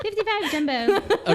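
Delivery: high, loud, slow laughing recall. Retold or imitated. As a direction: giggle the silly name. Repeating pager joke.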